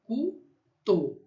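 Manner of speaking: "Cuto" in two separated syllables, cu, to. Shown as a demonstration. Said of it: "O U tem som de U".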